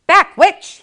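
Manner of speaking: loud, high Back, witch!; commanding. A narrator's reading. Max commanding his wife back, loud and high. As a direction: order her back forcefully. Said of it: "he commanded".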